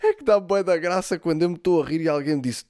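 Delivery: ordinary delivery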